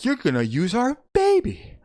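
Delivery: high, rising incredulous mother; shocked disbelief. Retold or imitated. Mother challenging husband over using their baby, shocked. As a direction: squeal it in mock outrage, pitch climbing sharply.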